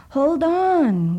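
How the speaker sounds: high, loud desperate wail. A narrator's reading. Douglas wails to family on the dock, desperate. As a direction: wail it as the boat pulls away. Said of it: "wailed Douglas".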